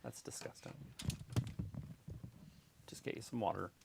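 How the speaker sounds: soft muttered teasing. Real, not acted. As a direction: mutter it under your breath. Muttered tease.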